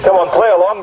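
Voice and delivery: normal talking voice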